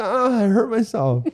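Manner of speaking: high, slow mock-baby wail; whining baby. Carpenter mocking his reaction to a small hole, babyish whine. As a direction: make fun of yourself as a big baby. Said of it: "Just baby".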